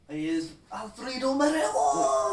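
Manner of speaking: high, loud, drawn-out Scottish cry; Scottish accent. Acted. Friend demonstrating his Scottish accent, loud cry. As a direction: shout it slowly in Scottish accent. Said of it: "with my Scottish accent".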